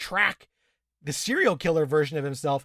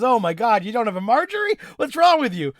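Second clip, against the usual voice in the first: high, loud, incredulous squeal that climbs higher; mock disbelief; 6 semitones higher, 5 dB louder than usual. Friend retelling a baffled man's reaction, hammed-up disbelief. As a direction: act shocked he has no Marjorie. Mock outraged quote about Marjorie.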